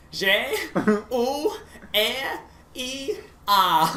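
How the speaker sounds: high, loud imitation of Spanish-accented spelling; broken English accent. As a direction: spell haltingly in a mock thick accent. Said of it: "he spelled it in very broken English".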